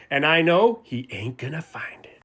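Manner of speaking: whispered like a guilty secret; conspiratorial whisper. Acted. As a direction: whisper it as if the teacher might hear. Secretly knowing where it is.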